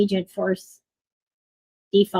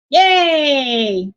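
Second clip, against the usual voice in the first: bright, loud little yay; excited cheer; 4 semitones higher, 6 dB louder than usual. Real, not acted. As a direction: cheer the win. Celebrating the completed challenge.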